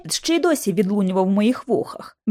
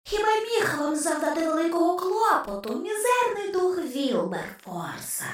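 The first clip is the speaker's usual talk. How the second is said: soft, otherworldly taunting voice; a skull needling the agents, hushed and sly; ghost's whisper; 7 dB quieter than usual. A narrator's reading. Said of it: "прошепотів голос". (тихий потойбічний глузливий голос черепа)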